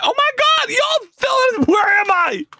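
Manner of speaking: very high-pitched exclamation; excited outburst. Real, not acted. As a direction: blurt it out high, all worked up. Sudden excited reaction to chat.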